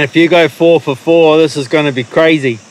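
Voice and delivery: ordinary talk, nothing marked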